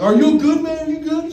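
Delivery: high, loud panicked check-in; mock-panicked high voice; frantic concern. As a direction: rush over asking if he's okay. Worried about the crashed kid.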